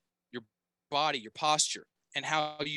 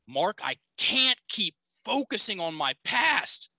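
slow, drawn out, higher, CAN'T stressed, PAST lifted; young man's anguished claim; 5 semitones higher, 3 dB louder than usual. Retold or imitated. Young man confessing to his coach as he turns round, slow and strained. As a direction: stretch CAN'T and FOCUSING, end PAST up high. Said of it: "This is what he says".

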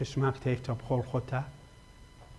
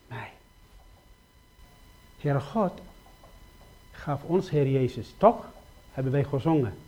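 normal talking voice

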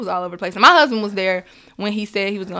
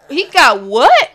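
louder, drawn-out rising question; stunned disbelief; 4 semitones higher, 7 dB louder, slower than usual. Retold or imitated. Mother recalling news of her baby's heart, incredulous. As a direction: stretch it out in mock shock, climbing on WHAT. Retelling shock at baby's diagnosis.

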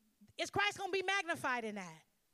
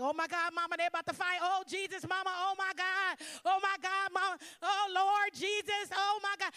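higher, louder panicked pleading; 5 semitones higher, 4 dB louder than usual. Retold or imitated. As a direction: panic as a fight breaks out. Panicking as fight starts.

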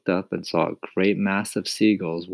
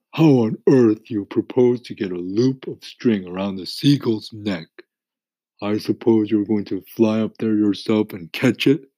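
earthworm voice; 3 semitones higher, 6 dB louder than usual. A narrator's reading. Earthworm protests.

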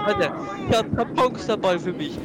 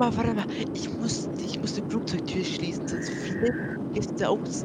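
whispered; 5 dB quieter than usual. Acted. Friend whispering about shutting the airplane door, hushed whisper. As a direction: whisper so the passengers don't hear. Whispering secret plan on plane.

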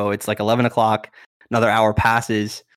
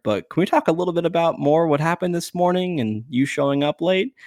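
higher-pitched, tentative broaching; awkward dad; 7 semitones higher than usual. Retold or imitated. Dad asks the trainer about showing up late, awkwardly polite. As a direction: lightly imitate someone raising an awkward subject. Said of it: "awkward conversation here".

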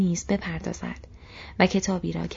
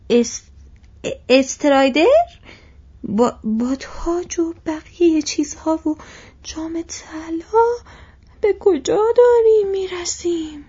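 much higher and louder, breathless amazement; gasping astonishment; 9 semitones higher, 9 dB louder than usual. Acted. Butterbur stunned to learn Strider's crown, gasping aloud. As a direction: Strider a king? he can hardly get it out. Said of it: "وقتی نفسش دوباره بالا آمد گفت".

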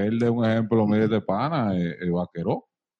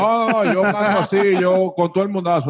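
high, loud, cocky party-rapper voice; 9 semitones higher, 6 dB louder than usual. Retold or imitated. Podcast host mocking the featuring guy, loud high brag. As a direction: brag like a swaggering reggaeton featuring star. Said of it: "el tipo del featuring".

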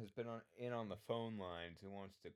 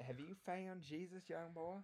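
a hint of a preacher, raised pitch; lightly voiced pastor; 7 semitones higher than usual. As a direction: play the pastor gently prodding a caller. Plays pastor on fake call.

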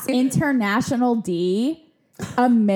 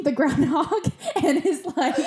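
words breaking into real laughter; giggling mid-story; laughing; 5 semitones higher than usual. Real, not acted. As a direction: laugh through it, can't hold it in. Laughing while retelling Emily's panic.

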